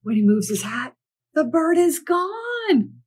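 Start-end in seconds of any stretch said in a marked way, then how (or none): 1.3-3.0 high, slow, wondering; amazed reveal. A narrator's reading. Reading to children as the bird vanishes, amazed. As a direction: stretch it out in awe. Magic trick works.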